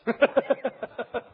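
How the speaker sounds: a short high laugh at his own joke; a quick amused laugh. Real, not acted. Laugh after the punchline.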